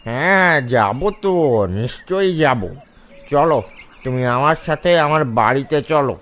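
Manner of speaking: the fox agreeing a little too readily. Acted. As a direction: fox promising the duckling to take him home. এই বলে শেয়াল.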